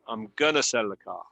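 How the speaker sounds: I'm gonna sell the car, gonna said as one quick word; informal gonna. Shown as a demonstration. Teacher modelling the casual form for the class. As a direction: drop going to into a casual gonna. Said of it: "la otra pronunciación es muy informal".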